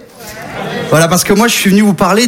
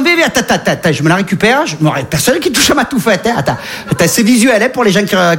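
put-on Marseille accent; 3 semitones higher than usual. Polar bear with a Marseille accent mocking the ecologist journalist. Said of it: "l'accent marseillais".

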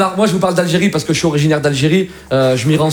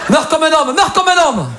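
high, forceful command; dramatic high-pitched exhortation; hollered order; 8 semitones higher than usual. Acted. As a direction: yell it up to someone on a balcony. Acted order to jump from fire.

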